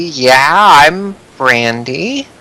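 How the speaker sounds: slow, drawn-out wary reply; cautious. Acted. Brandy cautiously answering the man who greeted her, wary. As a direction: answer guardedly, stretching the words out. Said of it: "she looks at you cautiously".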